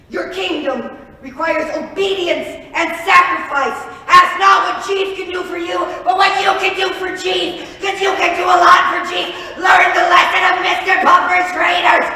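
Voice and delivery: loud, high rousing oratory; booming speech. Acted. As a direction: proclaim it to the masses. Grand propaganda speech.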